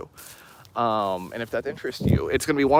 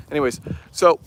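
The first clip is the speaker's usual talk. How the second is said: usual speaking voice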